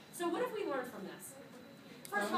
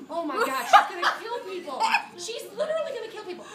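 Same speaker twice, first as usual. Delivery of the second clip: louder, higher, laughing through the panic; panicked laughing exclaim; 7 semitones higher, 9 dB louder than usual. Retold or imitated. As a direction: blurt it out in laughing alarm. Panicked thought at in-laws' dinner.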